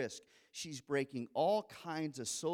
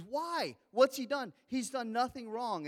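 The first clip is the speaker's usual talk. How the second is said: much higher, louder protest; incredulous shout; 9 semitones higher, 5 dB louder than usual. Retold or imitated. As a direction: cry out in disbelief. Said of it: "And he says".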